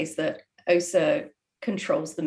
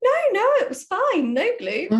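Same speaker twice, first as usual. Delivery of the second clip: high, louder, breezy mum voice; high, louder reassuring mum impression; mum's answer; 5 dB louder than usual. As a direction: brush it off like mum. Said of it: "And she was like".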